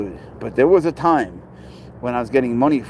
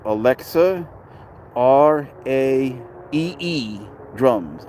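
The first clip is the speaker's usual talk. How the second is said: slow spelling out; much slower than usual. Shown as a demonstration. Streamer tells Rich to write it down, slow spelling out. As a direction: spell R A E E slowly, letter by letter. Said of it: "write this down".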